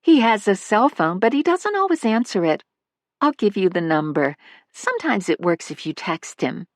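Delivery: high, light motherly voice; a fond older mother chatting about her son; elderly mother. Acted. Missus Manley gives number.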